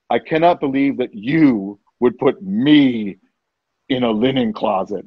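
measured, heavily weighted disbelief; indignant complaint. Retold or imitated. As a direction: spell out the grievance word by word.

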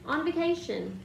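sharp high snap; snapped. A narrator's reading. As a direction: bite off the words. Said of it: "snapped Aunt Petunia".

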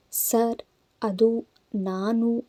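put-on a frightened girl stammering to her boss; ಭಯದ ತೊದಲು; faltering fear. A narrator's reading. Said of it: "ಶಬ್ದಗಳು ಏರುಪೇರಾಗಿದ್ದವು".

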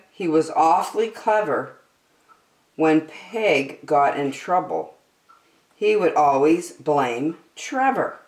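usual speaking voice

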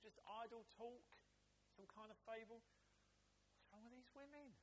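brushing off the women's news; scoffing disbelief. Acted. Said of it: "they thought".

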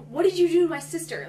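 high, loud mock accusation; hammed-up intense outburst; really intense. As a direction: demand it intensely, imitating a co-star. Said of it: "really intensely".

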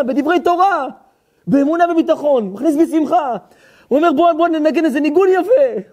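high, loud, rousing retelling; impassioned storytelling. Acted. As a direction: tell it with soaring, full-voiced fervor. (סיפור נלהב בקול רם וגבוה)

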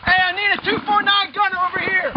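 real urgent yelling under fire; shouting orders. Real, not acted. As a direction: bark orders over gunfire. Officer yelling orders in firefight.

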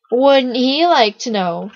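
slow, loud sing-song taunt; teasing echo. A narrator's reading. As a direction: throw the taunt back, drawn out. Steffi echoes the boy's taunt.

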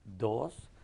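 put-on soft, low little-kid count; small son counting. Dad imitates his three-year-old son counting days, softly. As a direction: imitate a toddler counting shyly. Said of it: "y le hace".

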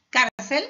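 Cárcel with a loud, punched CÁR; stress on penultimate syllable. Shown as a demonstration. Teacher giving grave examples, Cárcel stressed on CÁR. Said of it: "el golpe de voz está en la penúltima sílaba".

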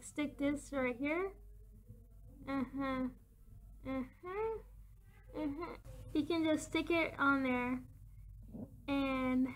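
fingers pressed on teeth; speaking around fingertips; talking with fingers in mouth. Real, not acted. Seen 0: Fingertips pressed to front teeth and lips while talking, placing something.

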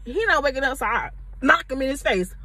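ordinary talk, nothing marked

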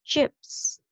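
Chips with a drawn-out hissed PS ending; Chips, lingering on the final S; stressed final sound. Shown as a demonstration. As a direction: model Chips, making the last consonants clearly heard. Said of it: "final sound, chú ý nè".

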